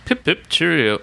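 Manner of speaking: high, chirpy caricature of a Londoner; mock London greeting. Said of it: "No, she didn't".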